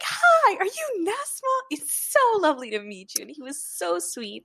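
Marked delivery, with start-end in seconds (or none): 0.0-1.6 put-on sweet old man. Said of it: "He's like". 1.7-3.2 put-on sweet old man. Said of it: "the cutest old man".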